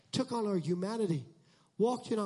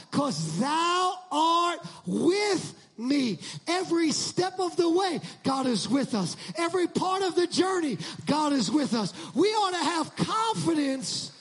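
preaching at full force; 8 semitones higher, 5 dB louder than usual. Real, not acted. Preacher rousing congregation, full-force shouting. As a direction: shout it out to the church.

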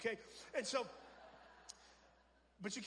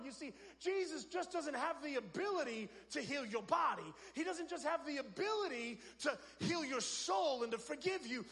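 preaching at full force; 3 semitones higher than usual. Acted. Pastor building to a climax for the congregation, fervent preaching. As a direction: lift the congregation, press each line harder.

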